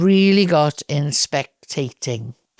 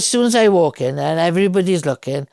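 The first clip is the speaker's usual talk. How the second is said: normal talking voice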